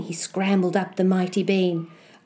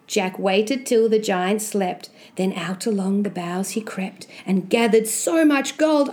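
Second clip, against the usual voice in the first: ordinary delivery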